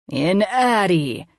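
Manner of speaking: very slow, playful menace; funny villain voice. A narrator's reading. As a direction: drag out the words like a mad scientist. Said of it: "in a funny voice".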